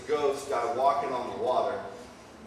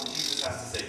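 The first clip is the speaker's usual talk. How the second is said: ordinary talk, nothing marked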